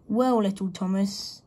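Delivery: ordinary delivery, nothing marked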